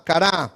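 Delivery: "kará" with its final A stressed; stress on last A. Shown as a demonstration. Teacher repeating where the accent goes, "kará" stressed on final A. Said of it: "Es, es en la última A".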